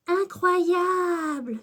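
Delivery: high, drawn-out cry of wonder; Cléa qui s'écrie. A narrator's reading. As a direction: stretch the word out in amazement. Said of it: "s'écrie Cléa". (exclamation émerveillée, très étirée)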